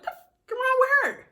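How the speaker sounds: incredulous stare voice. Retold or imitated. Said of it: "They looking at me like".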